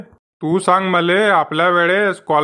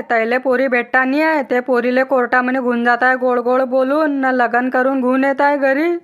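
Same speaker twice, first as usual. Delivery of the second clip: lightly put-on village woman, indignant gossip; a hint of a fussing village aunt, higher; 5 semitones higher than usual. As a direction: gossip like an aunt scandalised by the elopement. Acted woman character in skit.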